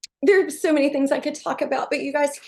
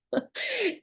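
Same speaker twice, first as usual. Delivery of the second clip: short laugh; 11 semitones higher, 6 dB quieter than usual. Real, not acted. Laughing at the total.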